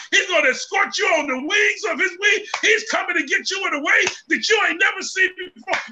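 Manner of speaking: rising, shouted gospel preaching; preaching at full force. Real, not acted. Preacher shouting to the congregation about heaven's party. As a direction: preach it out, building to a cry.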